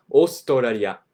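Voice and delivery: Australia as o-su-to-ra-ri-a; Australia with tapped r's, o-su-to-ra-ri-a; オーストラリア; Japanese Osutoraria. Shown as a demonstration. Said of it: "The country".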